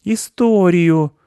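high, drawn-out insistent demand; demanding boy. Acted. Mark repeats his demand, high and stubborn. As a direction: stretch the word out in a high kid voice.

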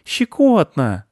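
high, stretched-out ticklish cry; boy's giggly, drawn-out protest; giggly ticklishness. A narrator's reading. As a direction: squeal the word as if tickled. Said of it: "захихикал он".

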